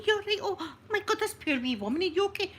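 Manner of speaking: high, loud fussing over someone hurt; flustered concern. Retold or imitated. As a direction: rush in with loud, high-pitched sympathy. Fussing over hurt old lady.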